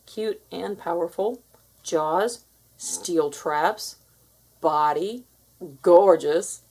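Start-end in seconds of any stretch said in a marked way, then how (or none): none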